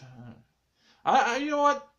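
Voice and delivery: ordinary talk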